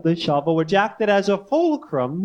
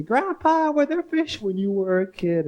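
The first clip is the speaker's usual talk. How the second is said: put-on wholesome boy's voice, starts high on Grandpa; Norman Rockwell-ish voice; 4 semitones higher than usual. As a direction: innocent kid asking his grandpa, lightly voiced. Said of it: "my best Norman Rockwell-ish voice".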